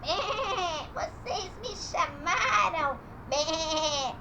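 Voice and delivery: quavering sheep voice with bleats; bleating sheep. Pretend. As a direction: talk slowly like a bleating sheep. Sheep puppet answers with bleats.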